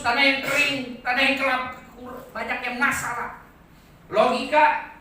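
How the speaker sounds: loud, high-pitched sermon cry; impassioned preacher in full flight; full-force preaching. Real, not acted. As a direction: proclaim it at the top of your voice.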